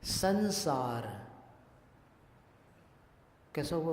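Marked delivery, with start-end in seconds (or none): 0.0-1.3 संसार with the anusvara as न, slow; संसार slowly, न-like nasal; nasal n demo. Shown as a demonstration. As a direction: stretch संसार so the बिंदी sounds like न. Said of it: "न जैसा हुआ ना".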